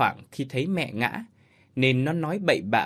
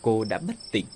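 ordinary delivery, nothing marked

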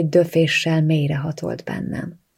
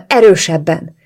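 urgent, louder hissed demand; 8 dB louder than usual. A narrator's reading. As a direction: hiss it out forcefully, pleading.

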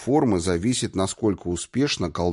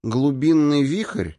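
slow, drawn-out, higher schoolteacher announcement; self-important lecturing; 6 semitones higher, slower than usual. A narrator's reading. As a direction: lecture dim pupils, stretching the term out. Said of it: "точно втолковывая нерадивым ученикам". (важно, назидательно, нараспев)

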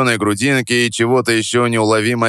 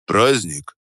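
weary. Acted. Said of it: "переспросил он устало".

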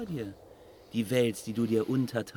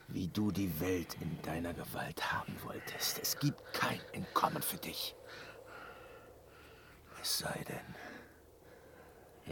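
lowered, softer teasing whisper; sly whisper; 6 semitones lower, 9 dB quieter than usual. Acted. Loge whispering a tempting offer to the captive. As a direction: lean in and tempt him quietly.

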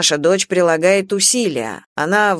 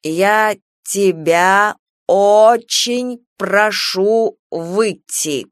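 very slow, deliberate, each syllable; sobered-up, spelling it out slowly; 3 dB louder, much slower than usual. Acted. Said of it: "протрезвевшим голосом, старательно по слогам, медленно". (медленно, по слогам)

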